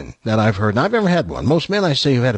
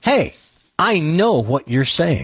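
slow, deliberate I know; slow pointed reveal; 2 semitones higher, slower than usual. Pretend. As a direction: lay it out slowly like a gotcha. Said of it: "What if you had said".

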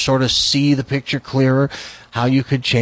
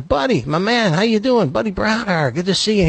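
put-on higher, hearty hello to an old friend; friendly greeting; 6 semitones higher than usual. Bill DeWitt greeting Buddy at the ballgame, hearty. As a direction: greet him like a long-lost pal. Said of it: "Bill DeWitt comes over and goes".

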